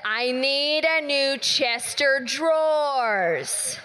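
slowly, through a laugh; slow repeat. Retold or imitated. Friend repeating his words slowly to pastor. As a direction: spell it out slowly with a chuckle.

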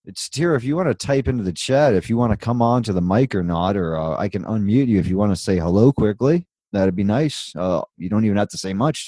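ordinary talk, nothing marked

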